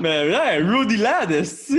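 loud, high exclamation of delight; excited shout. Real, not acted. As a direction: blurt it out, thrilled. Excited exclamation at guest's shirt. (cri enthousiaste)

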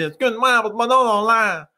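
put-on high, whining Saguenay accent. Diner asks from her banquette, Saguenay accent. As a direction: imitate a little lady's plaintive Saguenay accent. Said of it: "une petite madame sur une banquette".

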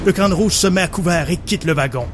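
narrating an action scene over music; dramatic story narration. Acted. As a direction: tell it like a movie trailer. Narrates his version of scene.

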